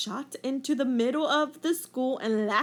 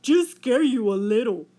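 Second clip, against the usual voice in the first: louder whale voice, other timbre; 7 dB louder than usual. A narrator's reading. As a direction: speak as the big gentle whale. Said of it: "said the whale".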